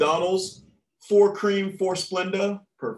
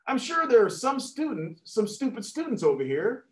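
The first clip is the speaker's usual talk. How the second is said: lightly voiced teacher, pitched up; 7 semitones higher than usual. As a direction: needle the class in a higher voice. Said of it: "Teacher again".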